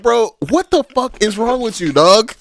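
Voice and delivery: high-pitched incredulous outburst; animated mock disbelief; exasperated Piccolo quote. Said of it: "Piccolo was like".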